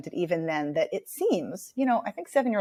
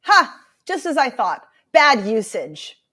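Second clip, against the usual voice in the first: smug teacher; 4 semitones higher, 7 dB louder, slower than usual. Retold or imitated. Teacher scolds student in front of class.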